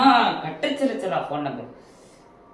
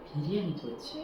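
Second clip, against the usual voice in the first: deflated; 16 dB quieter than usual. Acted. Deflated mutter after the call.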